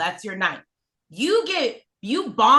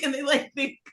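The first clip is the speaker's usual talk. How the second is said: normal talking voice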